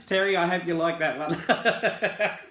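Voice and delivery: slow, loud, laughing. Real, not acted. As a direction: tease him while laughing. Teasing Terry while laughing.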